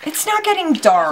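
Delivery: high, amused contradiction; high-pitched, incredulous denial; laughing protest. Real, not acted. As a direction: argue back through a laugh. Bantering contradiction with the children.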